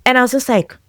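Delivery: ordinary talk, nothing marked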